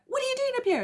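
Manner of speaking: very high, loud mock demand; imitated shouting. Man confronting her on the pyramid, mock shouting. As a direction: act out an outraged shouted question. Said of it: "he starts shouting at me".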